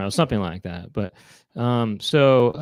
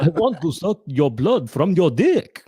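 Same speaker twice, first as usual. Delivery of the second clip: Bela Lugosi; 2 semitones higher than usual. Acted. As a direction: ham up the vampire accent. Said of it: "So this is Bela Lugosi".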